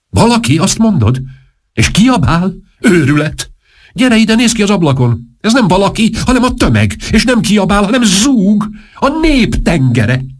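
high-pitched, incredulous tirade; agitated outburst. Acted. Panicked king scolds the duchess at the window, high and agitated. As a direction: throw the words back, high and frantic.

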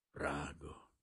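quiet grief, softly voiced; inward sob. A narrator's reading. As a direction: mourn it softly to yourself. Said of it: "egy zokogó hang".

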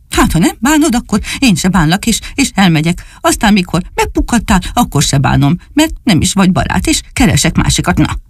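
offended little dog. Acted. Csupi tells Dani he will find another friend, huffy. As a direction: storm off in a childish huff.

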